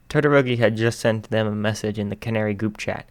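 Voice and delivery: ordinary delivery, nothing marked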